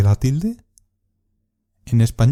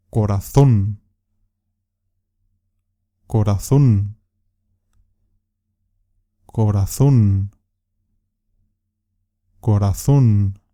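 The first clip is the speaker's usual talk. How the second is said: "Corazón" with the last syllable ZÓN drawn out and hit hard; exaggerated stress; 2 semitones lower, slower than usual. Shown as a demonstration. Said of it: "voy a exagerar un poco el sonido". (acento exagerado en la última sílaba de "Corazón", ZÓN)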